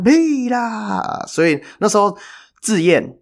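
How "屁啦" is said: whole section yelling at the ref, high mock yell; retold shout. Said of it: "整个就是大喊".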